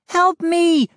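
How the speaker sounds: high, urgent cry for help; calling for help. A narrator's reading. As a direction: call out high and desperate. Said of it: "Mindy called back".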